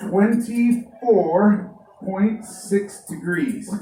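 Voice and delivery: "Twenty-four point six degrees" dictated very slowly; slow dictation. Shown as a demonstration. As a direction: read it slowly for writing down. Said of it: "Let me write that down".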